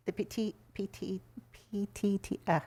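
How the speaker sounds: stumbling over the letters; tongue-tied. Real, not acted. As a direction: trip over the words, flustered. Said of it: "God, I can't even talk right now".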